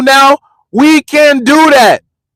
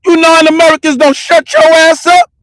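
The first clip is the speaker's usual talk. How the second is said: raised, higher retold yell; yelling it back at them, pitched up; 6 semitones higher than usual. Retelling what he yelled at the couple, raised and high.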